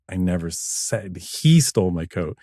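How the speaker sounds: "he" set apart and emphasized; stress on HE. Shown as a demonstration. DJ showing ways to say one line, stress on "he". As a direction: point the line at "he". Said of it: "this many ways to say this".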